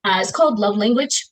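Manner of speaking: normal talking voice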